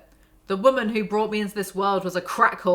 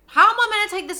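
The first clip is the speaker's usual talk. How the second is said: much higher and louder, fed-up disbelief; exasperated outburst; 12 semitones higher, 9 dB louder, much faster than usual. Real, not acted. Fed up with the book's imagery, an exasperated loud outburst. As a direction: throw the question at the book in frustration.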